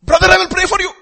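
high, loud preachy promise; mock the smug pious brother; pious hypocrite. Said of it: "Lot of people are like that".

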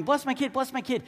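a touch of insistent begging; pestering parents. Acted. Parents keep coming to Jesus for blessings, mildly pestering.

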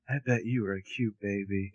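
soft, low sweet-talk; tender flirt.

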